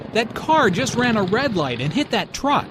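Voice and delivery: high, urgent report of a crash; lots of energy. Acted. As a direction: blurt it out with alarmed energy. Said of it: "said with a lot of energy".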